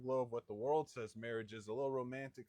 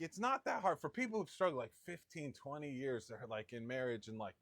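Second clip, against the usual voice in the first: normal talking voice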